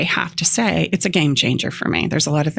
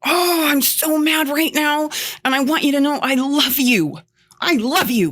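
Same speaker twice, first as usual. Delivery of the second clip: mock mad; 10 semitones higher than usual. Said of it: "I've said this mad".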